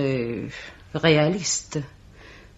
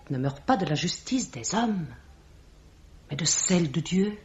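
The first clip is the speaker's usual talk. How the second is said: solemn whisper; 7 dB quieter than usual. Acted. Judgment of God pronounced.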